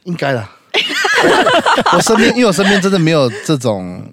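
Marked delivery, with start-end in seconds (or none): 0.7-1.9 high falling burst of laughter. Real, not acted. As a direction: laugh out, high then dropping. Laughing at idol drama joke.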